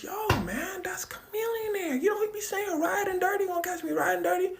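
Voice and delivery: high-pitched pleading explanation; voiced Paul Pierce.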